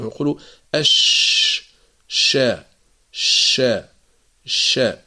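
أش and شا with a long airy ش; flowing shin. Shown as a demonstration. Teacher drilling the shin for the class. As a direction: stretch the ش hiss in شا.

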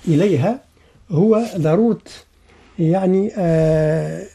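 usual speaking voice

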